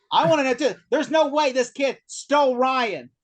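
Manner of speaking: obsessed insistence. Retold or imitated. Doctor sure the kid couldn't steal Ryan, obsessed.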